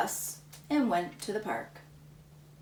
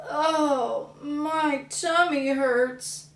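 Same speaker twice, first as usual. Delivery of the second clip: slow, higher whiny groan; sick boy; 6 semitones higher, 3 dB louder, much slower than usual. Acted. Samuel groaning at the sight of more pies, slow.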